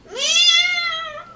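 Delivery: high squeaky meow for a whale call; whale sound attempt. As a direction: try a whale call, come out a cat cry. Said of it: "I'm trying to do a whale sound over here".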